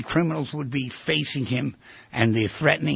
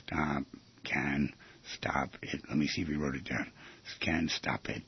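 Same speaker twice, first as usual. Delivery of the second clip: hushed, much softer murmur; whisper; 12 semitones lower, 13 dB quieter than usual. Real, not acted. Host murmuring the magic words to himself very softly. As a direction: whisper it as if to yourself.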